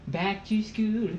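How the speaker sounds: high, slow goofy movie voice; silly Adam Sandler voice. Retold or imitated. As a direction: drag out back to school goofily. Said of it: "I hate that voice so much".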